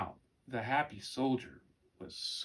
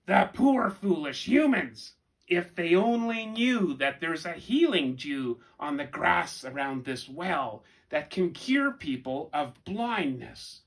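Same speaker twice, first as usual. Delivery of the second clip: higher, louder mocking bird voice; gloating raven; 4 semitones higher, 7 dB louder than usual. A narrator's reading. Raven mocking humans from the tree, loud high gloat. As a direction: sneer down at the foolish humans like a raven.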